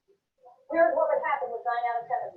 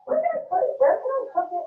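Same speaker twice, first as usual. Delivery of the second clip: searching brain; 9 semitones higher, faster than usual. Acted. Voices brain hunting for storage.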